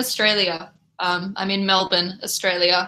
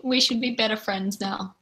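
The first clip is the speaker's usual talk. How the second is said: ordinary talk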